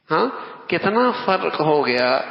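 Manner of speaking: ordinary delivery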